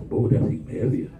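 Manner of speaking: ordinary talk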